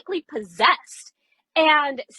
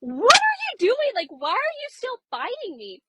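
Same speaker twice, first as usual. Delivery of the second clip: startled high-pitched protest, then dropping off; shocked outcry; 12 semitones higher than usual. Retold or imitated. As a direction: leap way up in pitch and demand an answer. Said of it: "And I'm like".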